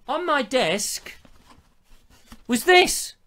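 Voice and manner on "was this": much higher and louder on the reveal; mock-theatrical reveal; dramatic reveal. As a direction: land it like a showman's big moment.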